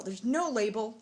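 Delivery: usual speaking voice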